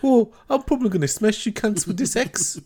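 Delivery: high, broad Aussie axe-killer voice; hammed-up Aussie threat; Australian accent.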